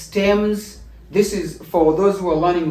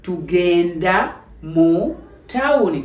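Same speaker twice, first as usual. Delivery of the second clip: slow, deliberate answer in role; drawn out the way a student answers; slow acted reply to the question; slower than usual. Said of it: "Somebody would say".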